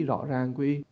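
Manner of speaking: ordinary talk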